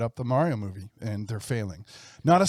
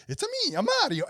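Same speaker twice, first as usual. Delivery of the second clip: high-pitched mock Italian accent; hammed-up Italian accent, high; fake Italian accent; faster than usual. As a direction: put on a cartoon Italian plumber accent, pitched way up. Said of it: "like, this fake Italian accent".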